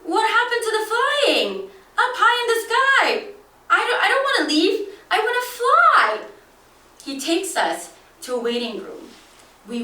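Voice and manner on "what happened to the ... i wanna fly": put-on high, fast little-kid voice, disappointed; a small boy begging to fly; child whining that he wants to fly; young child pleading. Young self protesting to guide.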